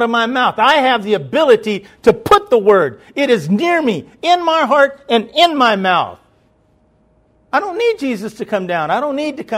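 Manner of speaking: loud, high, fervent pulpit climax; full-force preaching. Acted. Preacher driving home faith in his mouth, loud fervent preaching. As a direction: preach it hard and high to the congregation.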